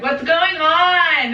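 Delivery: high, drawn-out mock wail; imitated panic. Panicked companion voiced in retelling.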